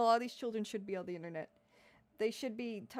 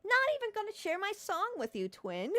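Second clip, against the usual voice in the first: meme quote; 9 semitones higher than usual. Retold or imitated. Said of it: "quoting meme again".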